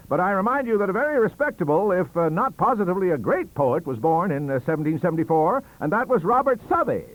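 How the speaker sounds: rebuttal. Acted. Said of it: "said he".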